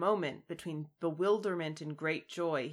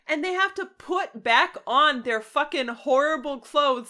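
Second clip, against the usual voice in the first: much higher, louder mock outrage; indignant rant; 8 semitones higher, 14 dB louder than usual. Real, not acted. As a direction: complain about the orc pants, fired up. Outraged about the clothes.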